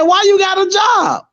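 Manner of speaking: high shouted imitation; mock shout as the guy. High shouted imitation of the guy mocking his job. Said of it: "He said".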